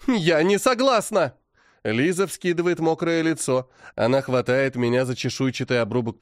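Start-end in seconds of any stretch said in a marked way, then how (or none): 0.1-1.4 tearful protest. A narrator's reading. Liza protests in tears.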